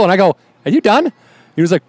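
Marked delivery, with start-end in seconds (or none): 0.6-1.1 high, dry put-down; lightly dismissive retort; mock calm challenge. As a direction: ask it like he's a child. Teller confronting biker.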